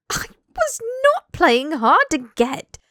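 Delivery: slow, loud, emphatic protest; offended and loud; indignant denial. Acted. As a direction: spit each word out deliberately. Draco indignantly denies the accusation.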